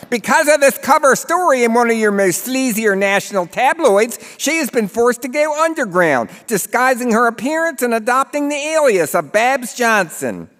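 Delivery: high, loud Baltimore narrator, hammed-up; thick Baltimore accent. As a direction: sell the sleazy movie like a Baltimore huckster, pitched high. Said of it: "He has a thick Baltimore accent".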